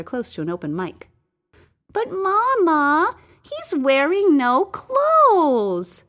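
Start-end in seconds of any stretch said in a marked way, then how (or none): 1.9-6.0 put-on much higher, very slow child's voice; a little child saying it innocently, drawn out; child in the studio audience tells Mama, high and slow; small child's voice. A narrator's reading. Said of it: "the voice of a child".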